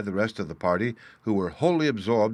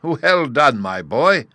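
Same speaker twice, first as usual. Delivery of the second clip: loud, high gleeful praise; loud cry; 6 semitones higher, 8 dB louder, much slower than usual. A narrator's reading. Father praising his cruel son, loud glee. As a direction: cheer the boy on. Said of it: "cried the Vice Warden".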